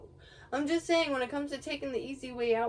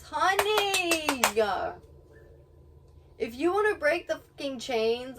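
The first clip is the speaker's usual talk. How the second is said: long exclaimed honey, pitched up; exasperated; 4 semitones higher, 6 dB louder than usual. Real, not acted. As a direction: stretch the word out in loud exasperation. Exasperated reaction to friend's excuse.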